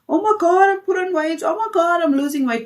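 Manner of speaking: high-pitched, hammed-up fretting; mock weight panic. Speaker mimicking someone obsessed with the scale, mock panic.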